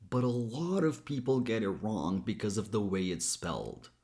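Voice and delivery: normal talking voice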